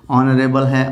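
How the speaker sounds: ordinary talk, nothing marked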